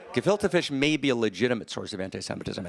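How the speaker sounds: normal talking voice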